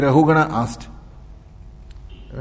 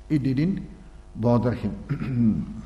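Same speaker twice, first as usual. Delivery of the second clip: it didn't bother him, drawn out very slowly and softer; slow soft repeat; 5 semitones lower, 7 dB quieter, much slower than usual. Shown as a demonstration. Teacher letting the lesson sink in for listeners, very slow and soft. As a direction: let each word sink in, slowly and quietly.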